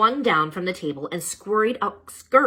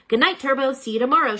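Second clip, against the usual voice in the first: lightly voiced teacher calling out, a bit higher and louder; called goodbye; 8 semitones higher, 6 dB louder, faster than usual. A narrator's reading. Teacher calls goodbye to the class hamster, lightly voiced. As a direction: call it across the room. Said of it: "she called".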